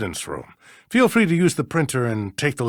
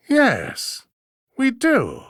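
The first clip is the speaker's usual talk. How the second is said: sudden realization; 10 semitones higher, much slower than usual. Acted. Judge suddenly remembers the footage.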